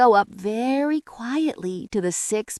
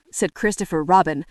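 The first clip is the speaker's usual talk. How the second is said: usual speaking voice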